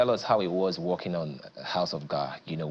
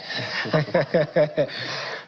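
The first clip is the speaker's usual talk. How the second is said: laugh; 8 semitones higher, 3 dB louder than usual. Real, not acted. Laughs at [name] joke.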